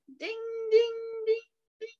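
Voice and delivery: high, ringing dings; sustained high-pitched bell imitation; train bell. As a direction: ring out like a bell.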